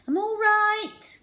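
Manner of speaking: high, slow squeaky teacher's call; trapped teacher calling out high and slow; calling from beneath. A narrator's reading. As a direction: call out from under the tuba. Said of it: "called Miss Midge from beneath her".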